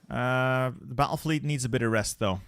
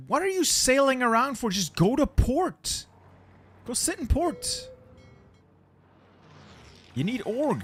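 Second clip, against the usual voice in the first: exasperated; 12 semitones higher than usual. Real, not acted. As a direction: scold the fleet, pitch rising. Scolding his fleet.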